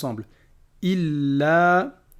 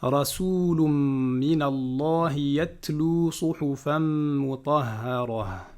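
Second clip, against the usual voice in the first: slow measured chanting of the verse; Quran recitation; much slower than usual. Acted. Teacher reciting Surah Al-Bayyinah to students, chanted. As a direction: recite each word with care.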